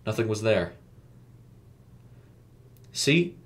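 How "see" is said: higher-pitched, relieved little question; lightly relieved, lifting at the end; hint of relief. A narrator's reading. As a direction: point it out with mild relief. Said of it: "a hint of relief emanating from his voice".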